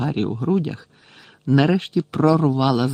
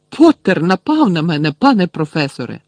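loud, high whiny accusation; indignant complaint; 4 semitones higher, 6 dB louder than usual. A narrator's reading. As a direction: tattle loudly to the teacher. Malfoy complaining to the teacher.

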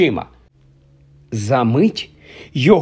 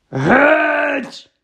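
very high, roaring bark; barked shout; 12 semitones higher than usual. Acted. Uncle barking the boys out of the kitchen, furious shout. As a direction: bark it like never before. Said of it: "гаркнув, як ніколи".

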